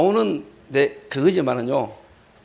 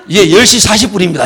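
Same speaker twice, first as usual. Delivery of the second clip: mock loud, dutiful answer; hurried loud reply; 8 dB louder than usual. Evangelist answers the yelling pastor, mock loud reply. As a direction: report the time loudly, imitating a subordinate.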